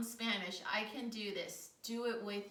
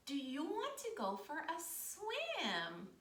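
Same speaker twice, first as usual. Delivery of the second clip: higher, slow, drawn-out question; duck character; 5 semitones higher, slower than usual. Pretend. Storyteller as duck inviting spider to swim, slow high duck character. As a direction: invite slowly, lightly voicing a friendly duck. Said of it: "And the duck says".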